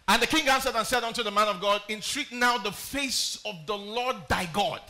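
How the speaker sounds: booming Bible reading; scripture reading. Acted. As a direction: declaim to the congregation.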